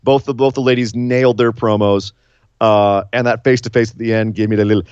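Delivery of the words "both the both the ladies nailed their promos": usual speaking voice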